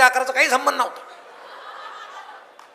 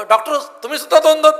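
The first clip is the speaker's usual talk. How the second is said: louder, higher call of amazement; surprised exclaim; 5 semitones higher, 6 dB louder than usual. Retold or imitated. A man seeing double exclaims to the doctor, loudly surprised. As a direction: blurt it out, startled.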